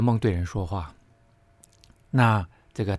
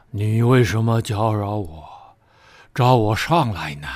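ghost voice; 4 dB louder, slower than usual. Acted. Samuel's ghost speaks through medium.

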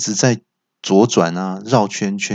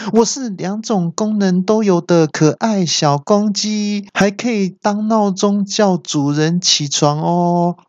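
可爱小公鸡; 10 semitones higher, slower than usual. Acted.

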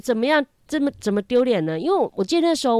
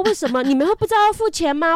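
exasperated scolding; 8 semitones higher, 5 dB louder, faster than usual. Retold or imitated. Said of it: "我说".

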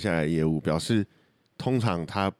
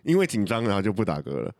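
ordinary talk, nothing marked

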